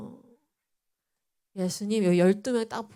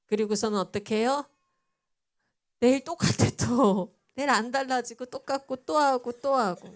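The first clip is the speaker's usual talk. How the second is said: usual speaking voice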